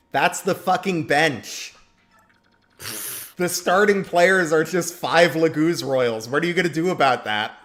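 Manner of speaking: excited. Real, not acted. Hyping his dream basketball team.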